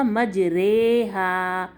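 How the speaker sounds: مجریہا as slow "majrayhaa"; very slow, held e in مجریہا; imala reading. Shown as a demonstration. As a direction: stretch the middle of مجریہا to a long "ray". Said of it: "تو یہ کیا ہوگا؟".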